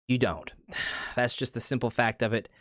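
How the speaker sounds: ordinary delivery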